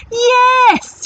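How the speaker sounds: high, loud cheer; shouted yes. Acted. Pirates cheer about a cook, high loud shout. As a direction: cheer it together. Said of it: "shouted the pirates".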